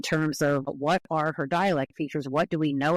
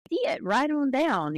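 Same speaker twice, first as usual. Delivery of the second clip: high, stretched Southern drawl with broken vowels; 9 semitones higher than usual. Shown as a demonstration. Linguist demonstrating vowel breaking, high Southern drawl. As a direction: exaggerate the Southern drawl, pitched well up. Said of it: "you elongate it and you break it into several different vowels".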